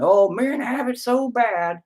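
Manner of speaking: high, slow, sarcastic whine; mock the eye-roll complaint. Mocking a sarcastic woman.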